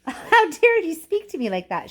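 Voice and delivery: mother shouting at Mister Wonka, outraged. A narrator's reading. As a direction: snap back, deeply offended. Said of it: "shouted Missus Salt".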